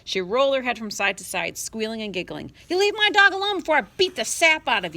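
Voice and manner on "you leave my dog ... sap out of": high, loud furious threat; angry order. Acted. Boy threatening his little sister over the dog. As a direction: bark the threat at her. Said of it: "Adam ordered".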